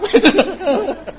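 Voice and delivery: a sudden high laugh bursting out; high burst of laughter. Real, not acted. Monk laughing at his own joke, a high burst of laughter.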